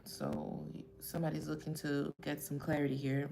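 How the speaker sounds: ordinary talk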